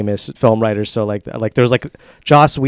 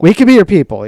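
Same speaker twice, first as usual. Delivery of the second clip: eager echo; 7 semitones higher, 7 dB louder, faster than usual. Real, not acted. Echoing with excitement.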